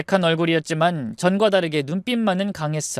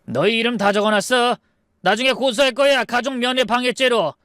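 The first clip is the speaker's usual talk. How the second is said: high, intimidating warning; forceful threat; 6 semitones higher than usual. Acted. Eldest brother threatening hospital staff with a lawsuit, forceful. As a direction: threaten them with raised pitch. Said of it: "더 강하게 협박했죠".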